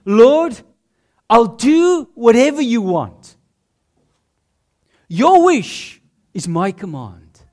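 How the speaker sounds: fervent cry of surrender; eager devotion. Retold or imitated. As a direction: call out Lord as a devoted follower. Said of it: "It's like saying".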